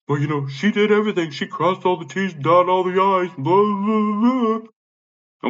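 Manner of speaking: high, loud mocking imitation; dismissive mimicry, climbing into loud blahs; mocking the brother. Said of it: "and he's like".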